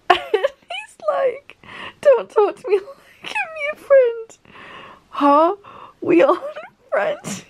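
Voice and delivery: breathless high laugh-talk; wheezing laughter. Real, not acted. Reader quoting Genya while wheezing with laughter. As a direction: quote squeezed out through wheezing giggles.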